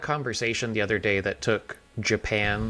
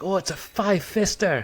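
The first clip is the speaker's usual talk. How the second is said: put-on high-pitched, terrible Scottish accent; [name]'s bad Scottish accent; 11 semitones higher, 3 dB louder than usual. Husband imitating his wife's cousin over mullets, bad Scottish accent. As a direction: do a badly faked, excitable Scot. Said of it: "this is a terrible accent".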